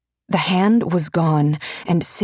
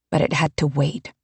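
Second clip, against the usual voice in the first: ordinary delivery, nothing marked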